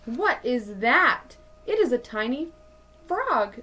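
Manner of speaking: ordinary delivery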